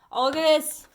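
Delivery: sudden delighted discovery; light up at what you see; surprised. Real, not acted. Surprised by a card.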